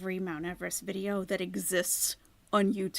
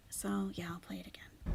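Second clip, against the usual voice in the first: whispered; 9 dB quieter than usual. Real, not acted. Quiet aside before replaying the clip.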